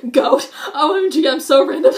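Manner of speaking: high, loud, shrieking mock of an annoying person; mock the try-hard random type, laughing. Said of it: "don't be the annoying person".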